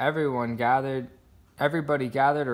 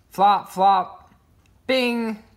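flop and bing sounds; 8 semitones higher, 6 dB louder, much slower than usual. A narrator's reading. Sound words.

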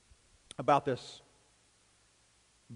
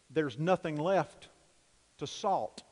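ordinary talk

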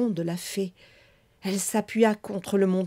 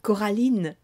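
ordinary delivery, nothing marked

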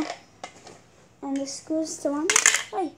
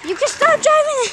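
loud, fast, high call; shouting; 10 semitones higher, 11 dB louder than usual. Real, not acted. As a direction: yell across the yard to stop. Shouting at brother.

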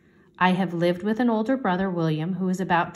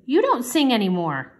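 louder, higher, accusing statement; sudden harsh reproach, pitched up; 6 semitones higher, 6 dB louder than usual. A narrator's reading. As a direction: blurt it out harshly. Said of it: "He said it harshly".

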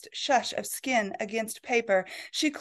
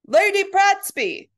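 loud, much higher slow call across the room; butler calling out; 10 semitones higher, 8 dB louder, slower than usual. Acted. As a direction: shout her name from the doorway. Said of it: "who'd called out".

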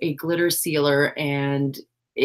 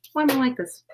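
higher repeat; 6 semitones higher, faster than usual. Real, not acted.

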